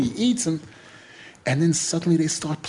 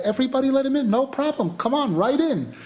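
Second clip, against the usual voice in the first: high-pitched, animated wave-through; breezy welcome; 9 semitones higher than usual. Retold or imitated. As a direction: usher them in eagerly, pitch way up. Guards waving him straight in.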